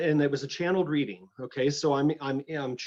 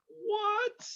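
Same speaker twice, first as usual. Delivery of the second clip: high, shocked squeak of disbelief; stunned what; 5 dB quieter than usual. Retold or imitated. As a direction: Matthew recognising the woman from the old reading, stunned high what. Shocked at meeting the woman.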